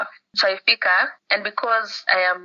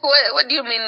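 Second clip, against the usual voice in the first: incredulous; 6 semitones higher, 4 dB louder than usual. Retold or imitated. Incredulous at doctor's answer.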